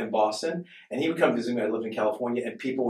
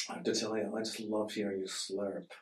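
very soft, lower-pitched quote; mock-serious confession; 4 semitones lower, 13 dB quieter than usual. Friend confessing why he won't come on the podcast, mock-serious and hushed. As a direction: imitate an earnest, hushed admission. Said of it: "he said, seriously".

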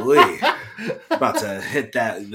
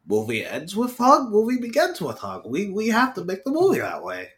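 a lightly voiced higher Tommy Wiseau, half mocking; 8 semitones higher than usual. Retelling his roommates the line in a put-on higher voice. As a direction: let it rise up from deep in your soul, mock-earnest. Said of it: "from deep in my soul".

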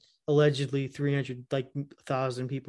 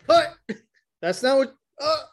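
high confused actor; 9 semitones higher, 5 dB louder than usual. Retold or imitated. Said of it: "he's like".

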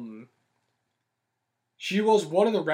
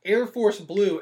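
ordinary talk, nothing marked

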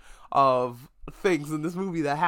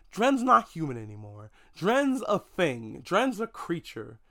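slow, weighted words; slower than usual. Real, not acted. Mocking the scientist's turn.

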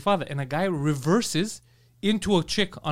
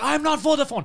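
imitated indignant worker; 8 semitones higher than usual. Employee defending himself to customer, high mock indignation. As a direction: push back in pitched-up mock indignation. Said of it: "they're fighting".

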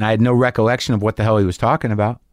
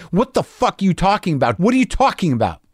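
agitated confrontation; 8 semitones higher than usual. Retold or imitated. Walked up to him in the hallway, agitated. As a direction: confront him, voice riding up high.

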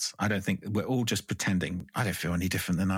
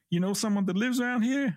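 higher, mock-suspicious cop questioning; imitating a cop; 12 semitones higher than usual. Cop questions the visitor, imitated higher and suspicious. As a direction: quiz him like a wary officer. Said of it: "I have to explain".

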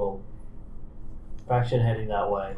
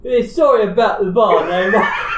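loud, high-pitched Cockney; hammed-up Cockney, breaking into laughter; Cockney accent; 7 semitones higher, 6 dB louder than usual. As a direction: shout it like a mocking Cockney. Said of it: "Hans' accent was Cockney".

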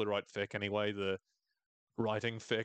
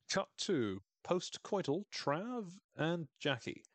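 slow, higher trailer-announcer read; movie trailer voice; 7 semitones higher, slower than usual. Shown as a demonstration. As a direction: announce it like a film cut. Dramatic scene-setting aside.

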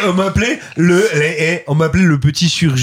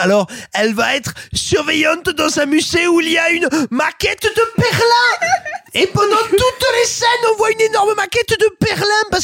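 mock shout. Mocking the director's reasoning.

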